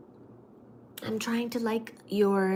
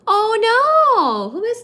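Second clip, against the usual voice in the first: high, loud, drawn-out cry of concern; dismayed oh no; 13 semitones higher, 10 dB louder, slower than usual. Real, not acted. Streamer reading a sad comment, loud dismay. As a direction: gasp in sudden worry. Viewer says she is crying.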